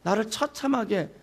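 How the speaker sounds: loud, desperate cry of protest; anguished protest. Acted. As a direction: cry out as if abandoned by the Father. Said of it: "라고 항변하는 거예요".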